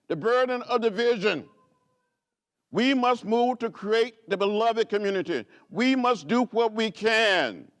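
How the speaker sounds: stirring public address; rousing oratory. Acted. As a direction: preach it to the crowd, then bring it home. Rising oratory, civil rights speech.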